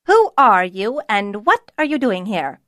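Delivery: stern cook confronting an intruder, brusque; brusque demand. A narrator's reading. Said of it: "demanded brusquely".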